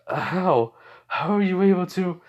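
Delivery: stunned, can't believe it; shocked disbelief. Retold or imitated. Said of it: "As Razor goes".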